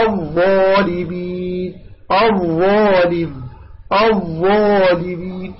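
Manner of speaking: very slow الظالمين with dark rounded ظا; measured recitation, full-mouthed الظالمين. Shown as a demonstration. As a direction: stretch the heavy ظا in الظالمين. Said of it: "تستعلي بها".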